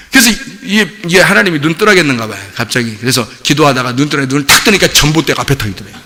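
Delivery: normal talking voice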